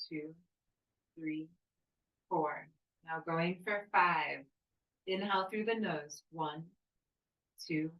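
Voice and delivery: pace the breath count calmly for the class; guided breathing count. Acted. Leads timed breathing with counts.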